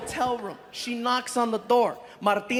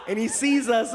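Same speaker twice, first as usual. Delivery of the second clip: ordinary talk, nothing marked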